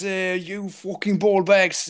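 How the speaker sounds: hammed-up British accent jab; mock British accent. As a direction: drawl the insult in a put-on British accent. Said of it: "Gotta have some sort of, uh, British accent".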